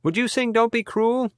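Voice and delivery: very high-pitched audience member's yell; heckler calling out. A narrator's reading. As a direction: call a song request up to the stage, high-pitched. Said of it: "Then the voice continued".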